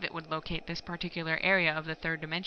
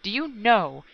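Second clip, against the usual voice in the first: louder, higher angry yell; 4 semitones higher, 6 dB louder than usual. A narrator's reading. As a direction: hurl the question at him. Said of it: "he yelled".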